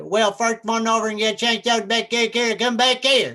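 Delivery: put-on high, garbled Southern kid gibberish; Delbert. Cornered visitor copies the farm kid's speech, garbled Southern accent. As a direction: parrot an unintelligible Kentucky twang. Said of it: "I just imitated Delbert".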